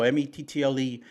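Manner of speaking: spells mettle letter by letter as M E T T L E; spelling out. Shown as a demonstration. Host spelling out mettle for listeners, letter by letter. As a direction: say each letter of mettle separately, M E T T L E. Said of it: "our, our mettle".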